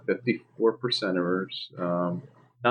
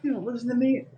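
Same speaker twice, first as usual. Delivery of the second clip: mock scolding parent; 7 semitones higher than usual.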